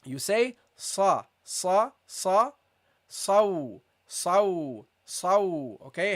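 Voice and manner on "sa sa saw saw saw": teacher shows the heavy letter sad. Shown as a demonstration. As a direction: make sa, saw heavy and emphatic. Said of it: "with a heavy letter".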